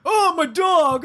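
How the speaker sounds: drawn-out mock shout; slow shouted impression of a friend; impressionist. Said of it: "Now do an impression of me".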